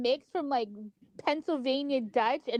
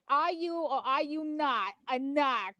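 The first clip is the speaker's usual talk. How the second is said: slow, drawn-out Boston movie quote; Boston accent; slower than usual. Retold or imitated. Quoting The Heat in a Boston accent. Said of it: "that movie with Melissa McCarthy".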